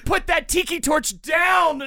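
high, slow mock plea; drawn-out begging; pleading hero. Joining the pleading skit.

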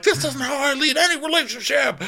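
very high mocking voice; mocking Shep again, very high. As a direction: sneer it out. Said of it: "he's like".

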